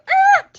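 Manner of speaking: short high shout as she slaps; a sudden high cry; sharp yell. Retold or imitated. Slapping her passed-out date, sharp high yell.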